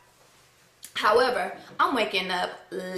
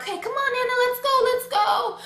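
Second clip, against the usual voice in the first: high-pitched, pleading urgency; acted high, impatient coaxing; urgent hurrying; 12 semitones higher than usual. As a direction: rush someone along in a high voice. Hurrying nana to the car.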